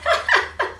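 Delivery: high giggly burst of laughter. Real, not acted. Giggling at her oversized new outfit, a high laugh.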